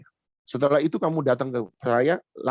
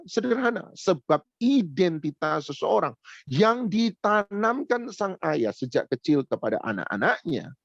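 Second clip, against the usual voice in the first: preaching emphasis; 4 semitones higher than usual. Acted.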